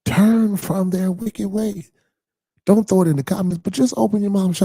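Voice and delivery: high, loud pulpit preaching; high, loud whooping sermon voice; full-force preaching. Acted. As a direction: lift it and drive it like a Sunday climax.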